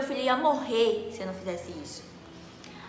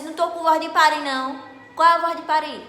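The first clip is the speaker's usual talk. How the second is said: loud, raised-pitch indignant comeback; angry retort; 6 semitones higher, 9 dB louder than usual. Retold or imitated. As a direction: throw the nurse's words back at her, loud. Retruca furiosa, jogando a lixeira. (retrucando com raiva, em voz alta)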